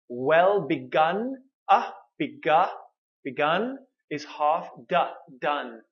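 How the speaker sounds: "Well begun is half done" broken up slowly; slow drill. Shown as a demonstration. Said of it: "please repeat after me".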